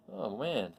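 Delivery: ordinary delivery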